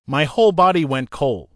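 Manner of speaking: ordinary delivery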